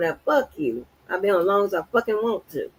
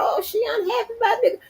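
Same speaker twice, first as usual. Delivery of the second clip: mocking quote; 12 semitones higher, faster than usual. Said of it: "Get on here and cuss a motherfucker out".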